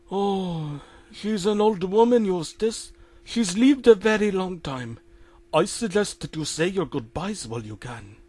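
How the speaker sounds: put-on consoling Indian-accented doctor.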